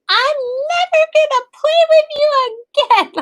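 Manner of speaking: put-on stamp it out like a huffy little girl; sulky four-year-old. Four-year-old sulking at her brother, very high pouting child. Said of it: "My four-year-old will be like".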